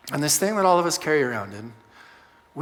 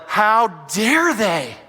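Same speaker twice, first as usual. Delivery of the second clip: drawn-out, building higher and louder; slow rising outrage; 5 semitones higher, 5 dB louder, much slower than usual. Retold or imitated. Pastor voicing anger at a coworker, slow building outrage. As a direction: let indignation build on each repeat. Colleague failed at work.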